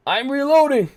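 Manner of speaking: high, loud panicked call; urgent shout. Real, not acted. Gamer shouting while reloading under fire. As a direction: shout it mid-firefight.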